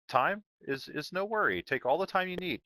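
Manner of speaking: a hint of a cheery computer announcer; automated Portal voice. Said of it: "the automated Portal voice".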